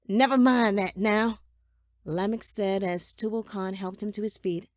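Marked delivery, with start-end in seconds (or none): 0.1-1.4 loud, brusque brush-off; impatient dismissal. A narrator's reading. Father silencing son's questions, loud and impatient. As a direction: cut the questions off loudly.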